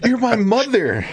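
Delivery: high, laughing mock protest; mock outraged son. Retelling the comedian scolding his mother, high laughing mock protest. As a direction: squeal it through laughter in disbelief. Comedian retold arguing with mom.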